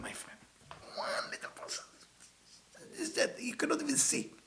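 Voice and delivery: unvoiced dramatic whisper, as a secret. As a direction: lean in and whisper it. Dramatic aside to listeners.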